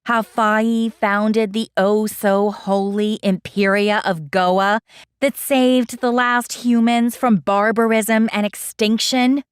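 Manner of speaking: ordinary delivery, nothing marked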